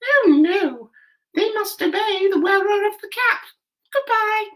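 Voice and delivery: very high, squeaky little mouse voice; squeaky mouse queen. A narrator's reading. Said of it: "in her squeaky little voice".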